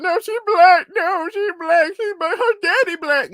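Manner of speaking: high, loud, whiny mimicry of protesters; exaggerated high-pitched imitation of indignant people; mocking objectors. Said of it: "people are like".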